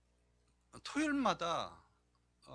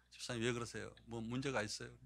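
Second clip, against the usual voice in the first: gentle concern; 9 semitones lower, 4 dB quieter than usual. Retold or imitated. Pastor gently asks weeping deacon.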